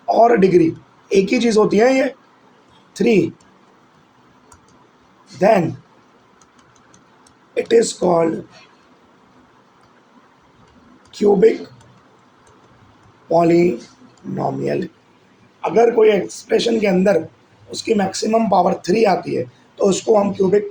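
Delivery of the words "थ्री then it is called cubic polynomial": each word spoken slowly with long gaps for writing; measured, deliberate note-taking dictation. Shown as a demonstration. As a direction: dictate it word by word so students can copy. Teacher dictates a definition for notes.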